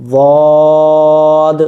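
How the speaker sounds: واد held long and loud with tongue side on side teeth; heavy ضاد. Shown as a demonstration. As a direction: stretch واد thick and heavy. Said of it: "نہ تو یہ زوات پڑھا جائے گا".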